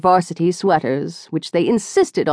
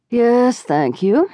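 listless; 5 semitones higher, slower than usual. A narrator's reading. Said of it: "Barbara said listlessly".

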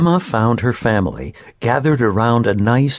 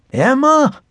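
high, loud call across the hill; shouting; 4 dB louder than usual. A narrator's reading. Grandfather calling for Emma up the hill, loud. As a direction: call out to her from far off. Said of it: "he shouted".

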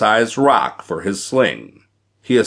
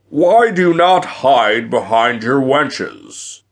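raised, booming giant's taunt; roaring giant; 4 semitones higher, 4 dB louder than usual. A narrator's reading. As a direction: taunt them in a big giant voice. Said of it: "the giant roared".

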